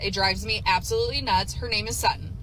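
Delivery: usual speaking voice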